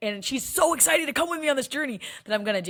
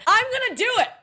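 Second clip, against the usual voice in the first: excited declaration; 13 semitones higher, 7 dB louder than usual. Retold or imitated. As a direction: declare it to the whole room. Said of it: "And I said".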